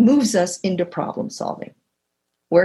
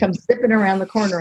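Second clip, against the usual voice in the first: usual speaking voice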